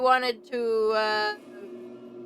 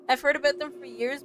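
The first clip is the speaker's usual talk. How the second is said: ordinary talk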